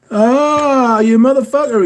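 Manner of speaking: high, loud burst of mock outrage. Real, not acted. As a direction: yell it at a cheeky friend, half laughing. Surprised by a message from a friend.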